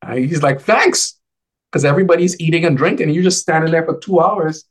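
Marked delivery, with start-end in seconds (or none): 0.6-1.2 high thanks. Retold or imitated. Grieving friend accepting water.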